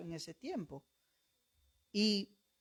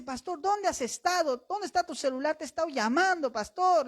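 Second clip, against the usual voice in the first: higher, eager calling out; urgent call; 8 semitones higher, 4 dB louder than usual. Retold or imitated. Church member calling urgently.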